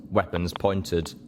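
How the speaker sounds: plain reading voice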